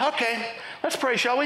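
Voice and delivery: high, mild recovery into prayer; lightly voiced elder moving briskly on to prayer; imitated unfazed elder. Elder recovering from a shocking request, imitated mild suggestion. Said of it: "this is exactly what he does".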